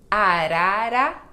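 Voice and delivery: Arara said slow and loud with tapped Rs; tapped R between vowels. Shown as a demonstration. Teacher slowly modelling Arara's tapped R for students. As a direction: tap each R of Arara slowly. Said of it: "tem o som de ro".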